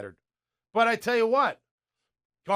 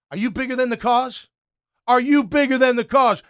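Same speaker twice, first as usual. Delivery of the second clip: loud, higher, challenging question; coach yelling; 6 semitones higher, 10 dB louder than usual. Retold or imitated. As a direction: confront the player at full volume. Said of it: "Tomlin is yelling at him".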